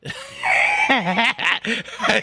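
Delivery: rising cackle of laughter; cackling laugh. Real, not acted. Vlogger laughing at his own joke about the lawyer, cackling. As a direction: laugh it up, delighted.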